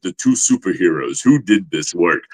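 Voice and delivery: low, imposing villain voice; imitated deep, serious actor voice; Cary Tagawa impression. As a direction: drop low and demand an answer. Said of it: "talking like this".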